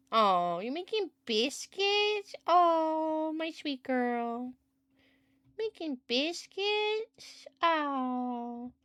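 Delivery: high, soft, slow cooing over the cat; soft adoring pet-talk; tender cooing. Real, not acted. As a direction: melt over the kneading cat.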